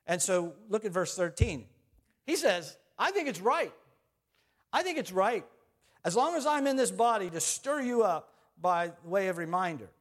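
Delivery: loud, high preaching voice; full-force preaching. Acted. Pastor preaching to the congregation, loud and high.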